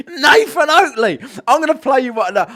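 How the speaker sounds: ordinary talk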